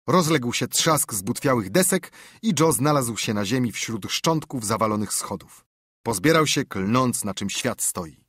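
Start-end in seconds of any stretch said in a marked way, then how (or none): none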